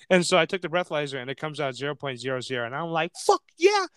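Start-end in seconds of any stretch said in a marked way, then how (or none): 3.2-3.9 high, loud acted celebration; triumphant cheer. Driver sees a zero breathalyzer reading, triumphant cheer. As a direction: cheer it with pitch shooting up.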